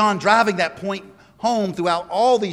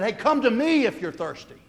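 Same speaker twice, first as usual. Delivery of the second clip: calling out; 7 semitones higher than usual. Retold or imitated. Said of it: "just like he yelled out".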